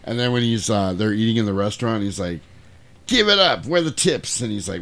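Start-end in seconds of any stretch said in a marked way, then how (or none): 3.1-4.4 higher, demanding boss impression; Joe from Reservoir Dogs. Boss demanding tips in the restaurant, hammed-up demand. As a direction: imitate a boss collecting money. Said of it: "was Joe from Reservoir Dogs".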